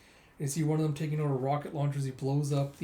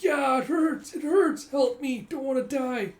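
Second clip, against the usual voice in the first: much higher, loud, desperate cries of pain; pained pleading; 11 semitones higher, 6 dB louder, slower than usual. Acted. Wounded man begging not to die, high and desperate. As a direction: beg for help as if dying.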